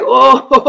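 loud, high laughing wail of "oh"; mock tearful crying. Preacher acting out the groom watching his bride, mock crying. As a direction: imitate an overjoyed tearful "oh-ho-ho", drawn out. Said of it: "The bridegroom is crying. He tearing up".

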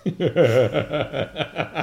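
long, rolling burst of laughter; real laugh. Real, not acted. Reader amused by Anne's optimism, real laugh. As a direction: crack up helplessly.

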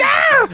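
a sudden high shriek of fright; startled shriek. Retold or imitated. Wife startled in the laundry room, high shriek. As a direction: scream as if caught off guard. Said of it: "She's like".

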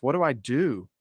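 usual speaking voice

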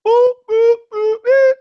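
high loud boops like a bleep tone; censor bleep. Acted. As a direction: squeak out bleep noises.

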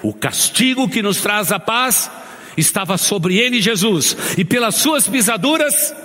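high, ringing sermon climax; full-force preaching. Acted. Pastor rallies the brothers to answer, full-force preaching. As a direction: lift the crowd toward the answer.

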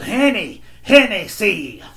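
high, loud, drawn-out repeat; impatient customer. Retold or imitated. Customer repeats her order to the confused clerk, impatient. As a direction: imitate a customer spelling it out impatiently.

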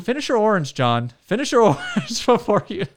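high, loud, laughing nag; hammed-up scolding mom; mock bossy voice. Teasing friend about the orange.

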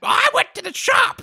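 high, slow squawking shout; parrot voice. Shown as a demonstration. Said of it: "Can you speak like, "Caw-caw"?".

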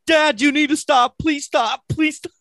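very high, pleading child imitation; Lila. Dad imitating his daughter begging on the ride, mock panic. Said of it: "Lila freaks the fuck out and starts going".

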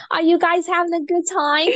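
put-on very high-pitched Harley Quinn accent. Hostess checking on party guests, Harley Quinn accent. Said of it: "I could totally pull off that accent".

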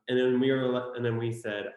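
ordinary talk, nothing marked

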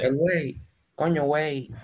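put-on light Mexican movie accent on "Coño, güey"; hint of a Mexican accent. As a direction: Dominican putting on a mild Mexican film accent. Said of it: "lo acentúan como uno de los clásicos mexicanos".

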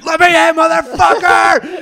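very high, full shout; retold screaming. Storyteller imitating himself at stranger's door, retold screaming. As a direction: bellow it as if locked out. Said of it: "I'm screaming".

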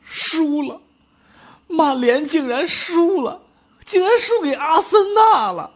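high, hammed-up lament; distraught. Lottery player lamenting Manchester United's defeat, high distraught wail. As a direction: wail it in comic disbelief. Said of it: "那你跟那儿瞎难过什么呀".

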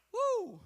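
high, loud joyful whoop; excited woo. Real, not acted. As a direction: let out a thrilled preacher's whoop. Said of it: "I'm getting so excited".

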